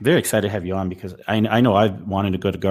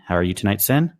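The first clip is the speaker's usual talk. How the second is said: normal talking voice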